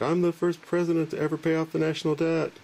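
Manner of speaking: high, puffed-up cartoon president boasting; proud Andrew Jackson. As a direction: brag in a silly high voice. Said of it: "he's like".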